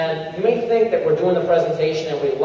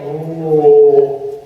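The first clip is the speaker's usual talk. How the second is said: mock deflating "ooh", louder; 3 semitones lower, 6 dB louder than usual. Shown as a demonstration. Trainer voices the room losing energy, mock deflating sound. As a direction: let the room's energy sag out. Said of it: "the last thing you need is energy going".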